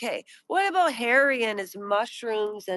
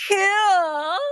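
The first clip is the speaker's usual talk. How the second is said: mock gasp; 10 semitones higher, 3 dB louder than usual. Mimicking Drew's reaction, gasp.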